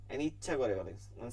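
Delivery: normal talking voice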